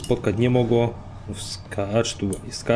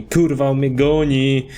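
slow, stretched cry of alarm; 3 dB louder, slower than usual. Real, not acted. Player chased in a game, alarmed drawn-out cry.

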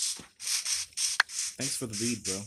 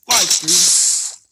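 loud, slow stretched words; drawn out; 8 semitones higher, 15 dB louder than usual. Real, not acted. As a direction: hold it long. Drawn out loudly.

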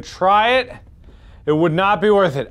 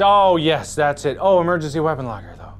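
ordinary talk, nothing marked

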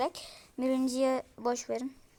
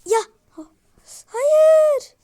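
shriek; 13 semitones higher, 12 dB louder than usual. Real, not acted. Gamer falls in game.

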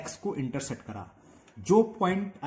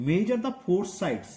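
slow, deliberate reading of the question; slow dictation; 4 semitones higher, much slower than usual. Shown as a demonstration. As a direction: read the task out slowly. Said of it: "four sides हमें major करके बतानी थी".

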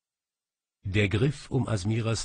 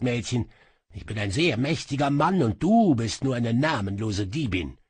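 higher, louder arrogant threat; menacing sorcerer; 4 semitones higher, 4 dB louder than usual. Acted. Sorcerer menaces the girl, arrogantly. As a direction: put the thief in her place.

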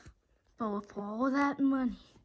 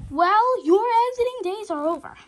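loud, higher gloating proclamation; triumphant declaration; 7 semitones higher, 16 dB louder than usual. Acted. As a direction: declare victory over the villain. Confronting the caught villain.

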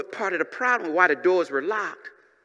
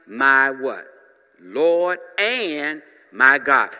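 slow dramatic; 5 semitones lower, 5 dB louder, much slower than usual. Real, not acted.

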